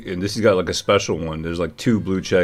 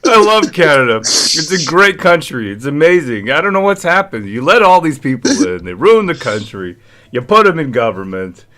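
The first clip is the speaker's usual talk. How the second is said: mock accent voice; 6 semitones higher, 5 dB louder than usual. Mocking impression of politician.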